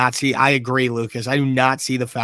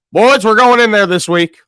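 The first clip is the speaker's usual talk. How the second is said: put-on loud, raised-pitch coach's rally in a country accent; 5 semitones higher, 7 dB louder than usual. Coach to his players before the game, country accent. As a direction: fire up the boys in a country accent. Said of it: "doing a country accent with this voice".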